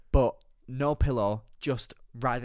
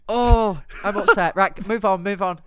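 mock upset; 6 semitones higher, 8 dB louder than usual. Mock upset, changing subject.

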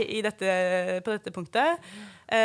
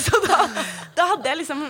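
higher, louder, laughing through the words; 6 semitones higher, 6 dB louder than usual. Real, not acted. Mother recalling the birth to the hosts, laughing out loud. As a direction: laugh at how meek she became. Laughing at her own story.

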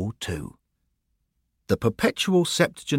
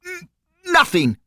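stammered nothing; 5 dB louder than usual. A narrator's reading.

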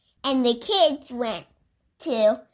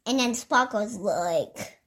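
plain reading voice

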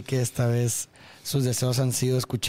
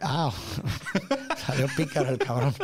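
laughing. Real, not acted. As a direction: laugh it out, amused. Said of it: "laughs at cheeky lyric".

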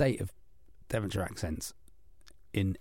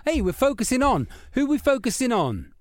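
high mock voice; 8 semitones higher than usual. Mock presenter voice to John.

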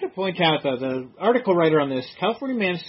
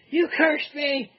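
put-on whiny ex-girlfriend voice; 8 semitones higher, slower than usual. Acting the ex-girlfriend's call.

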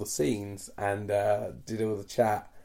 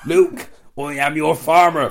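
louder, slow West Country villain line with "farmer" for father; West Country Darth Farmer; 5 semitones higher, 12 dB louder than usual. Retold or imitated. Friend mocking the actor's real accent, slow loud West Country voice. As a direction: boom it out as a rural West Country Vader. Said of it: "he used to have the nickname Darth Farmer".

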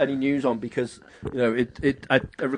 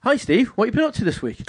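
higher, cheery mock host greeting; Steve impression; 7 semitones higher than usual. Host pretends to be Steve greeting Steve. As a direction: chirp the greeting up high. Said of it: "So I'll be my Steve".

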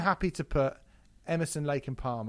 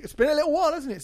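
mock excitement; 13 semitones higher, 7 dB louder, much faster than usual. Real, not acted. Excited about Indie Landfill.

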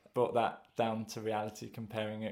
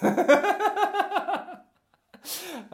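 laughing; 9 dB louder than usual. Real, not acted. Laughs mid-question.

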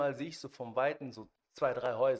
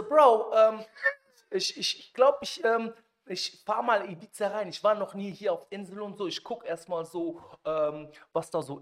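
nervous excuse; 7 semitones higher, 9 dB louder than usual. Retold or imitated. Nervously making an excuse to leave.

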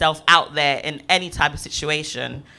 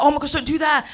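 higher-pitched, imitated motherly alarm; mock alarmed mother; 8 semitones higher, faster than usual. Mother warning her small son, mock alarm. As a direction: play a fretting parent, voice raised in pitch. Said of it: "fear in him".

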